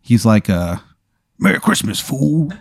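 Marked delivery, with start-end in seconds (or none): 1.4-2.5 higher, tough-guy street growl; Mister T voice. Acted. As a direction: bark it like a streetwise bruiser. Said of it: "talks exactly like Mister T".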